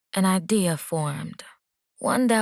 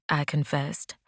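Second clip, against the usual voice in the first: plain reading voice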